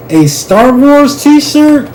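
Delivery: high, slow scoffing question; mocking. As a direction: draw it out in disbelief. Quoting Bernard's scornful question.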